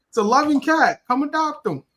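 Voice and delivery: mock adoption-ad voice. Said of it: "So I'm like".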